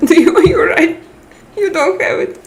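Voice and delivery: giggling disbelief; laughing. Real, not acted. Laughing, teasing the viewer.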